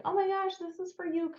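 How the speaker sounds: high, soft "oh my gosh"; gentler gushing. Shown as a demonstration. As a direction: coo it sweetly high, not pushy. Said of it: "Maybe not quite that aggressively".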